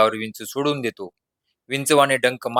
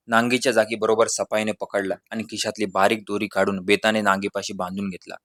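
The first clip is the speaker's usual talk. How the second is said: plain reading voice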